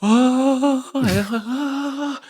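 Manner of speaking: high, long-held wail of wonder; mock starstruck "oh", drawn out high; Greg Brady moment. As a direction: hold it high like an inner fanfare. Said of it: "that Greg Brady moment".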